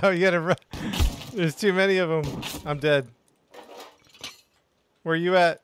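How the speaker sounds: loud and high, in a rush; panicked shouting. Real, not acted. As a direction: yell it over the gunfire. Overrun by enemies, panicking.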